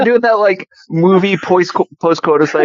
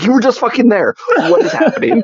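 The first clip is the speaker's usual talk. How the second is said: laughing disbelief; 7 semitones higher than usual. Retold or imitated. Acting her own baffled reaction.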